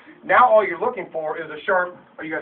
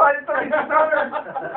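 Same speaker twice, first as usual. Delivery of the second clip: proud boast; 8 semitones higher, 4 dB louder, slower than usual. Retold or imitated. Retelling big sale triumph.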